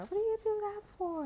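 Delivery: squeaky high, loud mock outrage; shrill mock squeal. Imitating a spooked person in a loud high squeal.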